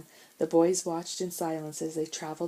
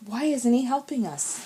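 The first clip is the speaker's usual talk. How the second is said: anxious plea; 6 semitones higher, 4 dB louder than usual. Acted. Stranded boys see officer leave.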